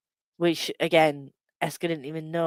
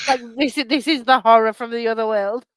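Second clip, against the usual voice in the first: high loud hammy announcement; mock spooky announcement; 6 semitones higher, 7 dB louder than usual. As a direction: announce a cute horror grandly. Voicing plush as the horror.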